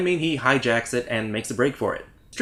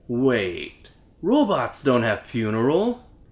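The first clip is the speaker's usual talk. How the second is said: usual speaking voice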